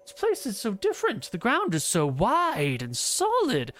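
put-on like a child seeing the world anew; wondering Euclid. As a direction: awestruck young man.

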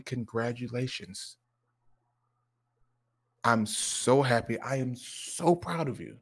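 slow, soft congratulations; heartfelt pride. Retold or imitated. Dad proud of son's report card, tender and slow. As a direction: tell a child tenderly, taking your time.

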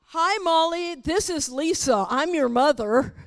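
lightly acted, over-announced phone greeting; mother phoning daughter, mock. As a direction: pretend to introduce yourself loudly and needlessly. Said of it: "if Lisa calls Molly on the phone".

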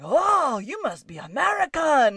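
loud, high put-on Irish lilt; imitating locals with a bad Irish accent; amused Irish-accent impression; mock Irish accent. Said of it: "they had Irish accents".

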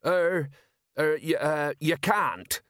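hurried objection. A narrator's reading. Merchant tries to stop the inspection, flustered. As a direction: stumble into a panicky protest. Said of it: "said Jenkins quickly".

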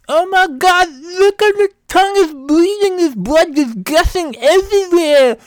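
very high, loud wail with tongue hanging out; tongue-out talk. Acted. Husband panicking to wife about his bleeding tongue, high tongue-out wail. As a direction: whine thickly around a stuck-out tongue. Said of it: "I just talked like that".

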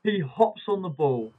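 normal talking voice

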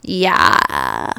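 low, creaky vocal fry. Shown as a demonstration. Host showing listeners what vocal fry is, in vocal fry. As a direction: drag it down into a loud, low creak. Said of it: "vocal fry is, like".

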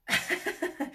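a short breathy giggle, almost silent; soft giggle. Real, not acted. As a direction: let a quiet giggle slip out. Giggling at God's question.

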